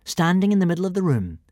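plain reading voice

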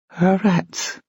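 high-pitched, startled echo; incredulous question. A narrator's reading. Sophie hearing about Charlotte's hats, high and incredulous. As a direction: leap up high in amused disbelief. Incredulous at second letter's subject.

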